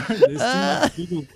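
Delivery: laughing through words. Real, not acted. Laughing at own joke.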